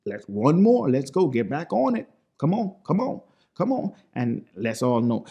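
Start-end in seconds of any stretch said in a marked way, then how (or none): 0.0-3.9 higher-pitched coach urging on; pushing coach. Retold or imitated. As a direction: egg them on like an insistent coach. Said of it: "What you gotta do as a coach".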